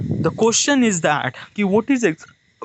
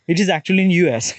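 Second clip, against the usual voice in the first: normal talking voice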